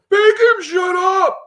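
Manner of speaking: shrill, loud, slow desperate yell; hollering. Acted. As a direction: scream back over the baby. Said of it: "The woman hollered back".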